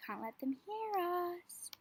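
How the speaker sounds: hushed, quiet nature-spotting voice; wildlife watching whisper. Acted. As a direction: whisper so you don't scare them. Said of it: "gotta be super duper quiet".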